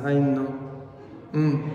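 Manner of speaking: usual speaking voice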